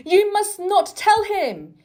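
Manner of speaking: loud, high angry shout; furious boy shouting at the doctor. A narrator's reading. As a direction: shout him down, forbidding it. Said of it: "he shouted".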